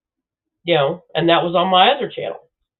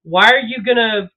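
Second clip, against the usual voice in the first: normal talking voice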